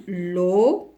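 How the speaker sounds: LOCO drawn out as LO, then CO; syllable by syllable. Shown as a demonstration. Teacher writing out locomotive, syllable by syllable. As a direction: hold each syllable of LOCO apart. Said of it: "Je vais l'écrire".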